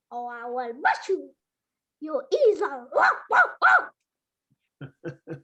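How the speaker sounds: high yapping dog voice with howls; guard dog character. Acted. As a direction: bark the challenge like a fierce little dog. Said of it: "Momotaro meets the dog".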